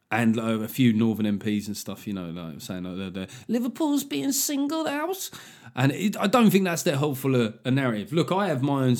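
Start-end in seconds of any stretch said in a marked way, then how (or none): 3.5-5.3 high, slow mock-Scouse complaint; squeaky caricature of a Scouser; bad Scouse accent. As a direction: whinge in a hammy, high Liverpool accent. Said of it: "some stick off the Scousers this week for my bad accent".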